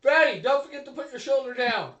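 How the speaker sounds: high, very loud coaching yell; shouted advice. Acted. Player reminding Fatty to drop his shoulder, shouted. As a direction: yell a reminder at a charging ally.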